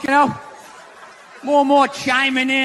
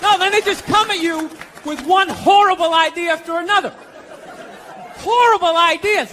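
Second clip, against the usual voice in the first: usual speaking voice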